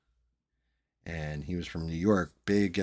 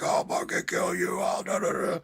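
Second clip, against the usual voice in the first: much higher, hammed-up raging threat; mock yell; 12 semitones higher, 4 dB louder than usual. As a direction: blow up like a hothead losing it. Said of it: "going to hell off".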